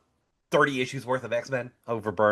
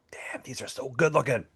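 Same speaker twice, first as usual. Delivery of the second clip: slow, whispered mock amazement; awed whisper; 5 dB quieter than usual. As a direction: whisper it slowly, like an admiring reader. Acting out awed reader reaction.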